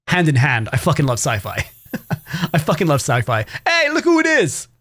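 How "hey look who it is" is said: high-pitched "look who it is" call; excited, high hello to a newcomer; excited greeting. Real, not acted. Greets someone, then redoes it low.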